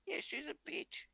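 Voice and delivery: high, breezy mock female cop; mimicking lady cop. Retelling the lady cop agreeing, high mocking imitation. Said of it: "She was just like".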